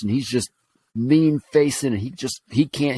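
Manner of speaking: ordinary talk, nothing marked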